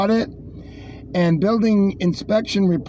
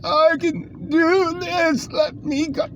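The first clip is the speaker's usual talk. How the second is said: high, slow mock brave worker; lightly voiced eager firefighter; 10 semitones higher, slower than usual. Quoting firefighters mockingly.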